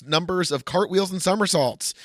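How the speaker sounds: usual speaking voice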